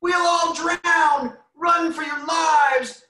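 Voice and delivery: very high, slow, loud scream; panicked chicken shout. Acted. Reading aloud, the chickens panic and run. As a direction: yell for all to flee.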